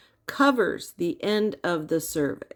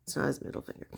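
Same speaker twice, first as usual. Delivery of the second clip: unvoiced, much softer aside; whispered aside; 5 semitones lower, 10 dB quieter, much faster than usual. Real, not acted. Teacher whispers that it is not the middle finger. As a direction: mutter it to yourself.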